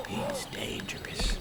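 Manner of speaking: slow ominous whisper; dramatic whisper. Pretend. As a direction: hush it like a movie threat. Ominous comment on boss.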